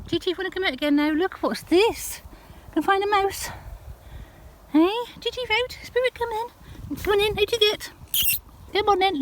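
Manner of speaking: high, sing-song calling to the dogs; coaxing pet talk. Real, not acted. Owner coaxing Chief and Spirit out of the hedge, high sing-song encouragement. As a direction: coax them out, high and encouraging.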